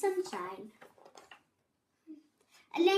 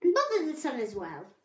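loud, rapid cross grumble; grumpy muttering; 6 dB louder, faster than usual. A narrator's reading. Babbitty bothered by the sun, grumpy muttering. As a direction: rattle out the complaint grumpily. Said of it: "he muttered".

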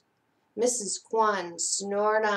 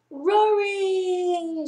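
high ringing call of the name; 8 semitones higher than usual. Acted. Calling out the requester's name.

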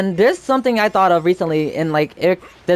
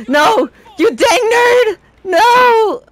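high, loud, drawn-out yell at the nerd; furious yell; 5 dB louder, much slower than usual. Real, not acted. Gamer yelling at attacking opponent, high shrieking yell. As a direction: scream him off your back.

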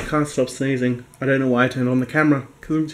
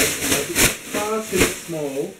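slow exasperated drawl; 2 semitones higher, 6 dB quieter, much slower than usual. Real, not acted. Griping to viewers about tiny garbage bag, slow, soft drawl. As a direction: drag out far too small, slow and soft.

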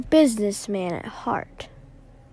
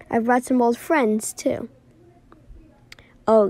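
ordinary talk

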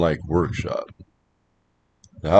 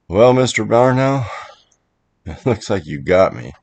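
higher, louder opening with rueful embarrassment, easing back down; embarrassed chuckle; 5 semitones higher, 4 dB louder than usual. A narrator's reading. Man addressing the owl that startled him, sheepish embarrassment. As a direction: sheepishly concede defeat, starting high. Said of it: "I chuckled with embarrassment".

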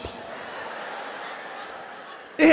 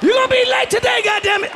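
taunting yell; 11 semitones higher than usual. Acted. Taunting a honking driver.

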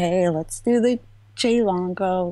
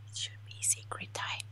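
conspiratorial whisper, much softer; 17 dB quieter than usual. Pretend. Streamer whispering to chat to start secret time.

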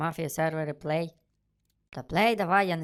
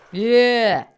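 loud, high cry of victory; triumphant cheer; 5 semitones higher, 8 dB louder than usual. Real, not acted. Player cheering a success in the game, triumphant.

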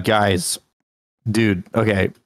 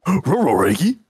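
higher, swooping doggy voice; cartoon talking dog; 6 semitones higher than usual. Streamer answering as the dog sidekick, cartoon dog voice.

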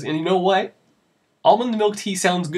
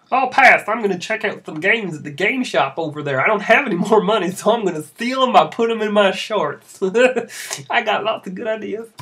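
higher, louder cheeky schemer; scheming kid voice; 6 semitones higher, 5 dB louder than usual. Acted. Wilson bragging he'll steal games, loud and gleeful. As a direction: brag about shoplifting like a gleeful little troublemaker.

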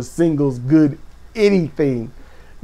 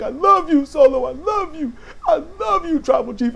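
much higher, gushing mock declaration of love; imitating gushing shout; 10 semitones higher than usual. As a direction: play the overjoyed henchman gushing to his boss. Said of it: "he's shouting that he loves Solo".

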